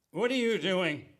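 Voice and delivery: put-on drunk, slurred question; hammered. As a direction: slur it like you've had far too many. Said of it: "I was pretty hammered".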